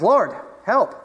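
retold cry out. Preacher voicing Peter crying out to Jesus, retold. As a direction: cry out briefly, as if calling for rescue. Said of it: "he cried out to Jesus".

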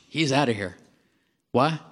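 ordinary talk, nothing marked

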